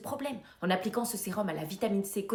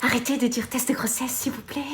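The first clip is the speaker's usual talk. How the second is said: pleading; 4 semitones higher, 6 dB louder than usual. Acted. Supplie d'arreter.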